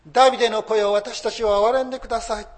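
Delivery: a preacher voicing a desperate cry. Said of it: "そう叫んだ".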